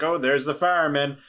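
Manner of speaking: ordinary talk, nothing marked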